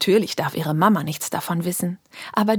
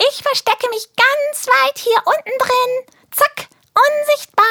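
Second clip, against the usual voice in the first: very high, louder, gleeful; tiny creature playing hide and seek; excited squeaky creature; 6 dB louder than usual. Pupsi Jones hides in the backpack. (sehr hohe, aufgeregte Piepsstimme)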